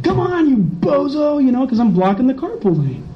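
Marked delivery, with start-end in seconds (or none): 0.0-1.4 mock angry driver.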